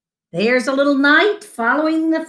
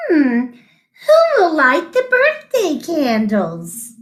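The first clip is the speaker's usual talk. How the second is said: mother asking; 5 semitones higher than usual. A narrator's reading. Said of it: "his mother asked".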